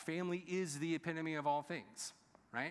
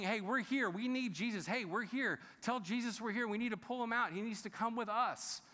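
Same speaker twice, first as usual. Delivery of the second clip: raised, urgent calls over a crowd; calling out; 6 semitones higher than usual. Retold or imitated. Jesus' family calling to him through the crowd, urgent. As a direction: shout to get through the crowd.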